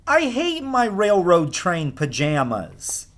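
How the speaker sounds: put-on grumpy kid listing his woes; whiny child. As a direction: sulk like a fed-up little boy. Whiny Alexander complaining at bedtime.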